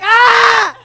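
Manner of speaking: high loud yelp from hot water; scalded scream. Retold or imitated. Customer feet in scalding water, high loud yelp. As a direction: shriek as feet hit boiling water. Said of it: "I'm like".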